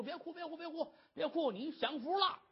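high-pitched, chattering coaxing; coaxing maidservants. A narrator's reading. Maids telling the crying wife she's lucky, coaxing. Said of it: "这儿劝着".

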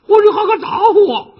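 very high, loud indignant cry; furious outburst. Acted. Slapped wife turns on her husband, furious shriek. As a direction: shriek back at the one who hit her. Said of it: "丫头火了".

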